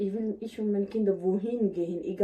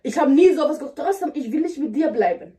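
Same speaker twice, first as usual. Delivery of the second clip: raised voice, louder and higher; angry; 4 semitones higher, 6 dB louder than usual. Pretend. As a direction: argue hotly at him. Staged quarrel with husband.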